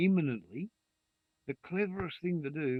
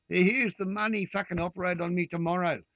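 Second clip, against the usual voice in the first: higher, louder mock plea; imitating desperate patients; 6 semitones higher, 5 dB louder than usual. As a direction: shove the cash at him, demanding. Desperate patients pleading with surgeon.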